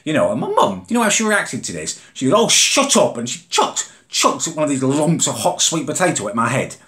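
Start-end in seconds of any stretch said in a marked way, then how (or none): 2.4-3.2 mock snapping mum. Mum snaps back, retold.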